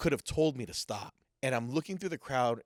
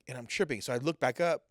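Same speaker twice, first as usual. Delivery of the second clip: ordinary delivery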